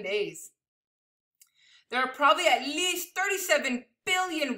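animated, emphatic picture-book reading; big numbers stretched out for effect; read-aloud to children. Acted. Reading a picture book of amazing numbers to children, animated storytelling.

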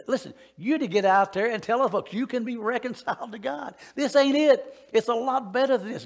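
rising to a loud, high preaching peak; building to a near-shout of excitement; excited preaching. Real, not acted. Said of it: "excuse me if I get excited".

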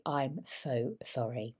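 slow, low, drawn-out sorry; hollow stock apology; fake sympathy. Shown as a demonstration. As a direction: overdo the pity so it rings hollow. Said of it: "it just falls flat".

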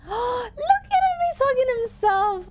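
high squealing delight; delighted gasp. Real, not acted. Streamer spots a hugging plush toy, squealing high. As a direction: gasp and coo at the adorable toy.

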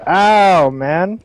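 loud high yelp of pain; pained shout. Real, not acted. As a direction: cry out as you get hit. Getting shot in game.

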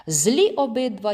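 plain reading voice

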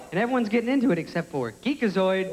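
ordinary talk, nothing marked

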